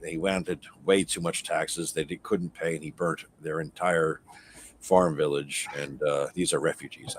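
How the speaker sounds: soft, low hushed aside; a quiet secret for his ears only; whisper. Acted. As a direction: lean in and murmur it quietly. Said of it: "I whisper to him".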